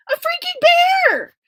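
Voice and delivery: very high, incredulous squeal; shrill disbelief. A narrator's reading. Narrator shocked a bear is at the door.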